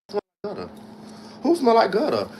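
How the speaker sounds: indignant disbelief. Real, not acted. Offended by a smell comment.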